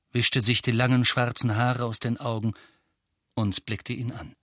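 ordinary delivery

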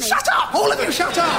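frantic yell. Pretend. Frazzled steward yells.

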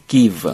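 "give" ending in a lip-on-teeth V; final V. Shown as a demonstration. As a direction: model "give" slowly with a clear final V. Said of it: "발음도 V가 있으니까".

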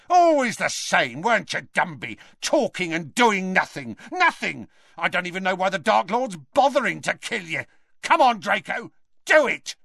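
high, agitated angry rant; violent yelling. A narrator's reading. Death Eater berates Dumbledore and urges Draco, yelling. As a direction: rant high, building to a command. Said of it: "yelled the Death Eater, suddenly violent".

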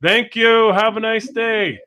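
loud, high mock-polite Canadian call; Canadian accent. Shown as a demonstration. Host shows co-hosts what to yell after honking, in a loud Canadian accent. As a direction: holler it in an over-cheery Canadian accent. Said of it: "with your best Canadian accent".

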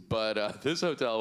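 ordinary delivery

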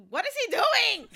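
high, loud baffled cry; shocked. Real, not acted. Fan reacting to idol's post, high loud shock. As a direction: yelp in disbelief. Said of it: "reacting to idol's message".

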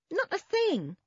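high, emphatic forlorn refusal; forlorn boy. A narrator's reading. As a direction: insist miserably, pitched well up.

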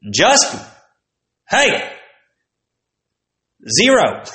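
high, loud teacher voice; teacher giving Justin a zero, pitched high; mocking teacher. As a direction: hand out a zero with glee. Teacher mocked.

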